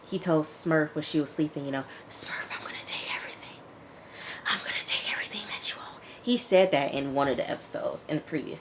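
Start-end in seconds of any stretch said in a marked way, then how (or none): 2.1-6.0 fast menacing whisper into her ear; retold whisper. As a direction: breathe the threat softly as she sleeps. Threat to sleeping Smurf.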